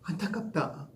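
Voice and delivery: quiet, sighing pity; soft pity. Shown as a demonstration. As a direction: murmur it with gentle regret. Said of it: "계속해서".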